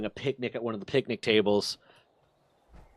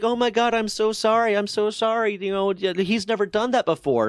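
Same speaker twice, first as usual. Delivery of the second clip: much higher, flustered apology voice; apologetic dog owner; 9 semitones higher than usual. Retold or imitated. Owner apologizing to the narrator for the dog, flustered high voice. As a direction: gush sorry like a mortified owner. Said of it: "it's like".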